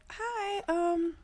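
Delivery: high, soft little greeting; polite. Retold or imitated. Woman phoning an unknown number, putting on politeness. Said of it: "So I politely said".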